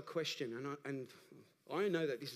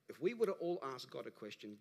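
ordinary delivery

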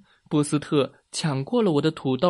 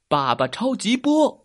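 superhero attack cry; 6 semitones higher, 3 dB louder than usual. A narrator's reading. Daddy Superman fires at the alien, high heroic cry. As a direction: call out your special attack. Hero shouts attack name.

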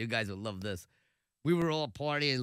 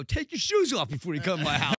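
mock scolding; 3 semitones higher, 7 dB louder than usual. Mock scolding visitor at his house.

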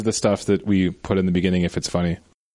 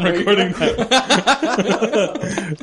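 laughing; 10 semitones higher, much slower than usual. Real, not acted. Laughing while answering.

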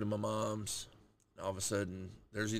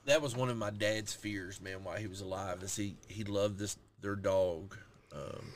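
ordinary delivery

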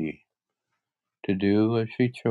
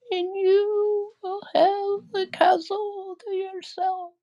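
wailing, despairing witch. Acted. The dying witch bids Dorothy farewell while melting, wailing. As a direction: draw out each phrase in a falsetto wail. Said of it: "in a wailing, despairing voice".

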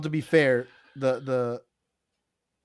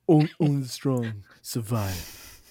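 slow dramatic quote; 4 semitones lower, 3 dB quieter, slower than usual. Retold or imitated. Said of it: "quoting the movie title line".

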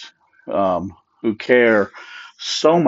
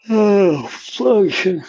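exasperated; 11 semitones higher than usual. Real, not acted. Exasperated outburst mid-thought.

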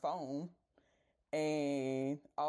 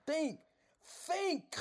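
exasperated think; 12 semitones higher than usual. Retold or imitated. Telling the friend to use her head, pitched up high. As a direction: think pitched up, climbing higher each time. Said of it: "I was just like".

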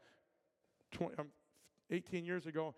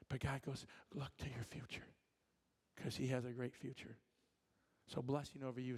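prophetic ministry; 3 semitones lower than usual. Acted. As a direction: speak a blessing gently and earnestly.

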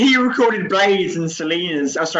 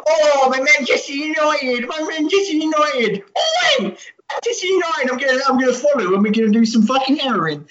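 much higher, whiny wailing voice; a high, whining, wailing Manchester United fan; mock wailing football fan; 9 semitones higher than usual. Drugged-up Trevor raving about United.

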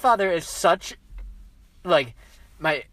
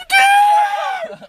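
very high, loud squeal of joy; excited shout; 9 dB louder than usual. Retold or imitated. Grandson reacting to grandfather's arrowhead gift, excited shout. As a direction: shriek it out with delight.

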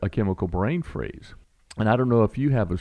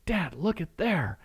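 lightly voiced little girl, higher and slow; child's voice; 9 semitones higher than usual. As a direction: imitate a five-year-old pointing something out to dad. Dad voices small daughter.

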